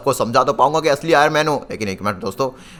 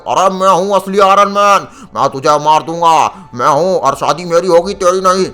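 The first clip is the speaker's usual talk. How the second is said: menacing impostor Iron Man; 3 semitones higher, 4 dB louder than usual. Acted. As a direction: boast and threaten like a supervillain.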